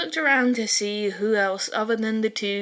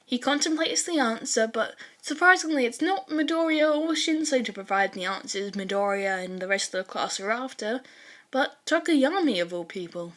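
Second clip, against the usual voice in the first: usual speaking voice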